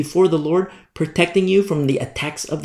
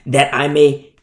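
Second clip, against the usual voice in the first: loud, emphatic verse reading; forceful reading aloud, slowing at the end; scripture reading; 5 dB louder than usual. Real, not acted. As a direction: proclaim the verse from the pulpit. Reads Bible verse aloud.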